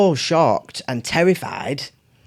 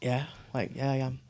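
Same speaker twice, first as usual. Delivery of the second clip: quiet confession; 9 dB quieter than usual. Retold or imitated.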